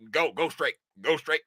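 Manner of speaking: low, soft mimicked directions; traffic-directing official. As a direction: imitate an official waving cars on, quietly.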